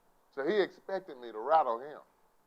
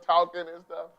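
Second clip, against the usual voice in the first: normal talking voice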